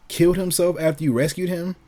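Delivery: ordinary talk, nothing marked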